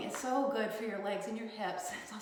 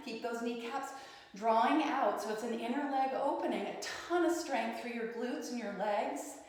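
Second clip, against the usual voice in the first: ordinary delivery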